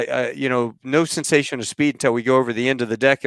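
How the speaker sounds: ordinary delivery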